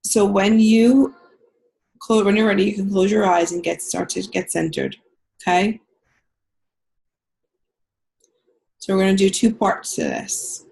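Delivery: calm guided healing meditation. Acted. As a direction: ease them into the visualization. Leads a guided visualization.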